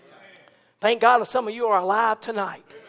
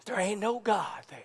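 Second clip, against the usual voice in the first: soft punchline; 4 semitones lower, 12 dB quieter than usual. Acted. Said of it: "quiet punchline after loud line".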